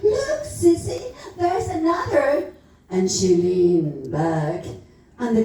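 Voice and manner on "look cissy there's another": put-on high-pitched excited child's cry; child's shout. A narrator's reading. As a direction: call out high like a little child spotting something. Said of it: "little Jackie Caffrey shouted".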